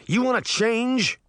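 a half-shouted challenge; mild shout. A narrator's reading. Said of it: "Uncle Jake shouted".

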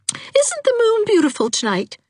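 very high, brightly put-on cheer; high-pitched forced cheerfulness; loud, cheerful tone. A narrator's reading. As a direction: announce it cheerfully for someone listening indoors. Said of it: "she added in a loud, cheerful tone".

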